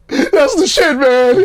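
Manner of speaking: a friend bursting with joy at the new track; excited friend. Retold or imitated. Friend reacting to his first house record, in an ecstatic high cry.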